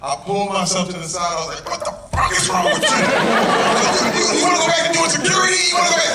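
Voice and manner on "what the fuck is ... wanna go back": high, loud self-scolding yell; yelling at himself. Retold or imitated. Goon actor cursing himself out after freezing on set. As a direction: chew yourself out, furious and sharp. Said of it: "I literally yelled and, and cussed myself out".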